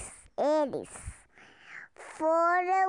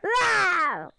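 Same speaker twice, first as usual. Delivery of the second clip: dinosaur roar; 2 semitones higher, 5 dB louder than usual. Acted. Mother roars loudly.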